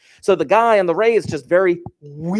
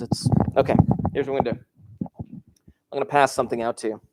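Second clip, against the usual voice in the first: normal talking voice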